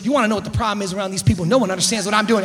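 rapid-fire grumble; imitated fast complaint after a bad call; acted venting wife. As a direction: vent quickly without a breath. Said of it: "she goes".

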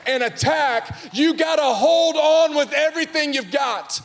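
high, loud, driving preacher voice; rally the church to fight back; fiery preaching. Acted. Preacher to congregation, full-force fiery preaching.

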